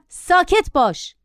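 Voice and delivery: high, drawn-out stern order; commanding tone. A narrator's reading. Passenger stopping the boy before another shriek, commanding. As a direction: order a child firmly to be silent. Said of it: "با تحکم گفتم".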